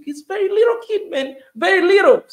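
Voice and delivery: loud, high, pushing out the words; outraged emphasis. Real, not acted. Outraged about abused small child.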